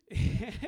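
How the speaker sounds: loud burst of laughter; sudden real laugh. Real, not acted. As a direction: break out laughing. Laughing at the absurd payout.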